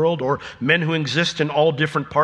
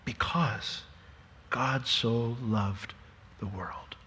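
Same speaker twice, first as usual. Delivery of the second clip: very slow, soft, falling to a whisper; hushed awe; 5 semitones lower, 12 dB quieter, much slower than usual. Real, not acted. Preacher answering why Jesus must die, in hushed awe. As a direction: answer softly, word by word, fading away.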